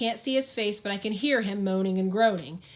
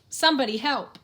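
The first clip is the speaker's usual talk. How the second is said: groaning plea; 4 semitones higher, 4 dB louder than usual. Acted. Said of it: "the Undertaker groans".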